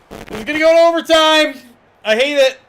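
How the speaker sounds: high, loud fan outburst; frustrated shout. Real, not acted. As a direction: yell it at the TV. Fan dreading overtime.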